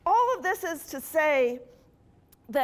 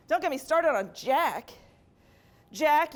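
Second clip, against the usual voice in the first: usual speaking voice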